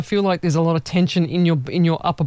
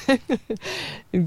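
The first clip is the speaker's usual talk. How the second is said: quiet giggle trailing off; sheepish little laugh after a slip; soft embarrassed laugh; 6 semitones higher, 6 dB quieter than usual. Real, not acted. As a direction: laugh it off softly. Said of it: "how embarrassing that was".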